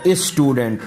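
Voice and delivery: स्टूडेंट said "is-tudent", "i" before s; wrong leading vowel. Shown as a demonstration. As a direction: open स्टूडेंट with an extra "is". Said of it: "ये भी नहीं बोलना".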